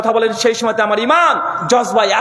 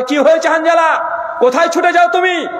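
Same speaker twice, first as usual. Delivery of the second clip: higher, raised call after him; 7 semitones higher than usual. Acted. Abu Bakr calling after running Hanzala, raised worried call. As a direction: shout after a running friend. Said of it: "চিৎকার দিয়ে বলছে".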